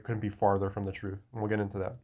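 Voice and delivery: usual speaking voice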